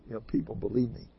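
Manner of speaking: normal talking voice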